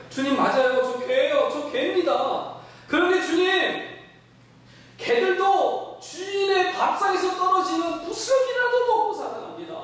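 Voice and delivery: humbly begging for just a crumb; pleading woman. Acted. Pastor voices the foreign woman humbly pleading with Jesus, high. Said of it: "뭐라고 그러냐면".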